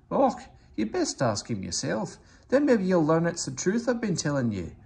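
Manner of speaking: lightly voiced Scottish engine, indignant; Scottish accent. Donald tells Sir Handel to ask himself, light Scottish accent.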